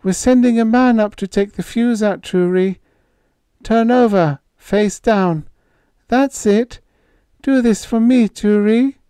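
put-on high, loud, trembling call of a girl; quavering call. A narrator's reading. Babs calling.

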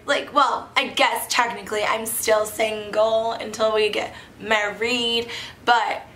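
usual speaking voice

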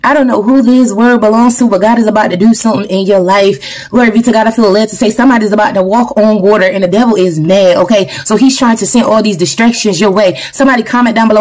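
ordinary talk, nothing marked

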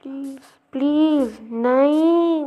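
loud, drawn-out plea; desperate begging. Pretend. Gamer begs to be spared, loud and desperate. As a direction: beg loudly, stretching the words.

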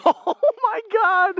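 laughing. Real, not acted. Laughing at friend in deep snow.

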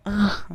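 short, very loud "ah"; sharp outburst of alarm; startled yell. Real, not acted. Startled by the game.

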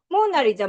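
"모나리자"의 "모"에 강세; 첫음절 강세. Shown as a demonstration. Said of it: "이렇게 연결해서 할 수 있겠어". ("모나리자"의 첫 "모"를 세게)

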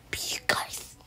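soft, voiceless whisper. Acted. Character offering to help you guys, whispered. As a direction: drop right down into a whisper.

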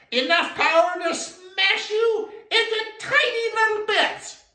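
high, loud shout of a tiny frog; tiny frog threat. Acted. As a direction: squeak out the threat at full force. Little frog threatens elephant.

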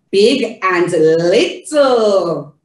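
BIG and LITTLE slow, drawn out and loud; contrasting concept words. Shown as a demonstration. Teaching a toddler big and little, exaggerated slow stress. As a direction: stretch out "Big", then "little", as opposites. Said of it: "you're teaching them concept as well".

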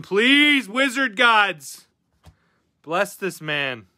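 high, slow pleading to the wizard gods; mock prayer. Streamer praying for a viewer's pack, hammed-up pleading. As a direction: beg the card gods, drawn out. Begging for a good pull.

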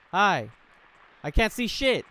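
loud jumpy outburst; startled shout. Real, not acted. Gamer yelling at a jump scare. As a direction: yelp it out in a fright. Startled in dark game room.

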